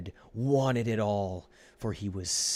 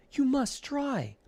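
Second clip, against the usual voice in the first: high, pleading little voice; urgent plea; 4 dB louder than usual. A narrator's reading. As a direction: beg him to come, much higher. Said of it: "pleaded Ed".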